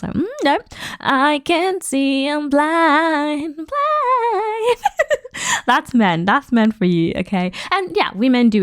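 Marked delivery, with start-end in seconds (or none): 0.1-4.8 high, slow, sing-song mock of a man pretending not to see; mock blind-eyed man. A woman mocking men who ignore red flags, high sing-song pretending. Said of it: "close their eyes like this". 4.8-5.6 high laugh. Real, not acted. Laughs after her mock bit.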